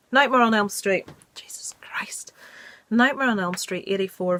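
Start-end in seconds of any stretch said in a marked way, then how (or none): 1.3-2.3 whispered oath under her breath; exasperated whisper. Real, not acted. Fumbling her notes, whispering in exasperation. Frustrated at misheard list title.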